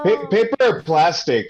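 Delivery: mock cashier. Imagined cashier voiced.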